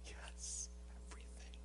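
barely audible whisper, no voice at all; hushed whisper. Retold or imitated. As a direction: breathe the words softly, like a secret. Pastor answers the weeping woman.

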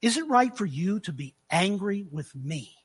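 low-key, soft, measured question; quiet challenge. Acted. God questioning the angry prophet, quiet challenge. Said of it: "He said".

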